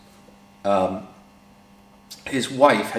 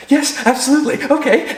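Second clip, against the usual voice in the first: much higher, louder hammed-up eager yes; mock the wife's starstruck eagerness; giggly eager wife; 5 dB louder than usual. Wife eagerly obeys Colin.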